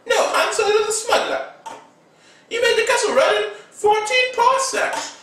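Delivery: very high, laughing and quick; high laughing kid voice; giggly Rey voice. Retold or imitated. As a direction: giggle through the line.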